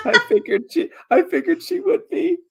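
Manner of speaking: high, laughing reply. Real, not acted. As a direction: laugh through the words. GM laughs at a player's remark.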